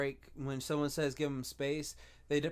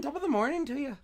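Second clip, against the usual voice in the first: high, exaggerated Irish greeting; Irish accent; 9 semitones higher than usual. Shown as a demonstration. Coaxing the co-host into it, mock Irish accent. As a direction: put on a cheeky Irish accent. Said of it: "gotta read it with an accent".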